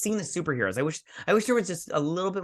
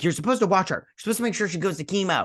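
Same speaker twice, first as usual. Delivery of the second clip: higher-pitched, hurried mock scolding; 6 semitones higher, faster than usual. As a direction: reproach them, voice pushed up. Acted scolding in retold scene.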